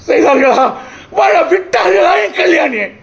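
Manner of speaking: high-pitched, impassioned cry of reproach; anguished accusing outcry. Acted. Brother accusing society over his sister Kalyani, anguished outcry. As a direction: cry the questions out at the top of your pitch.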